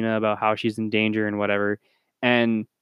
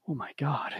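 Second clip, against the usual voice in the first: hushed mock reaction; 4 semitones higher, 10 dB quieter than usual. Quoting unimpressed reaction, much softer.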